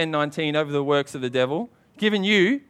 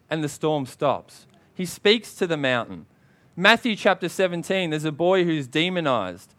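usual speaking voice

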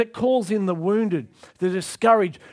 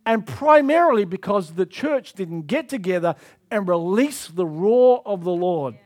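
usual speaking voice

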